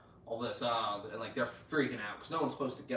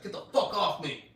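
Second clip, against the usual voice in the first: raised, higher-pitched mock outburst; mock angry protest; 6 semitones higher, 3 dB louder than usual. Frenchie fending off man tending his wounds, mock angry. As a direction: push someone away in a retold quote.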